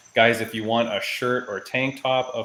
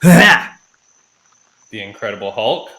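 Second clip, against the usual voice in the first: announcer voice; 13 dB louder, much slower than usual. Acted. Hyping a fighter's name.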